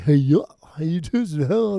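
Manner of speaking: mocking a singer's goofy sound. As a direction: put on a goofy, silly voice. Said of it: "goofy at times".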